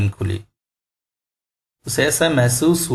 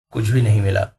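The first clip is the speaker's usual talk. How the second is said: ordinary delivery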